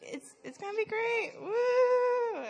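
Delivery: mock-excited, faking being pumped; hammed-up forced cheering; fake enthusiasm. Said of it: "And I'm like".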